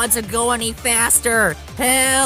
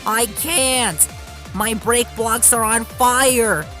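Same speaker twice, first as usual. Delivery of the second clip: high, wailing shout; panicked reply; 9 semitones higher, 4 dB louder, slower than usual. A narrator's reading. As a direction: cry out helplessly in alarm. Runaway engine in panic replies.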